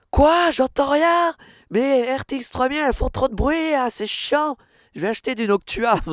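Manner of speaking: high shouting over loud fans; yelling across the room. Pretend. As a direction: yell like you can't hear over the noise. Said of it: "là en mode".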